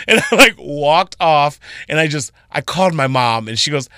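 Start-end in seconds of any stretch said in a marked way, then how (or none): none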